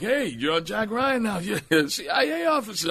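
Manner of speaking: high-pitched imitated sheriff; delighted sheriff. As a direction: tease the actor in a high lawman voice. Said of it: "he'll be like".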